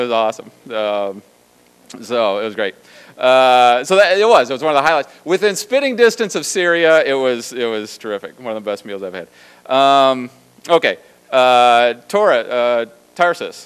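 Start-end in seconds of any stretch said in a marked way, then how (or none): none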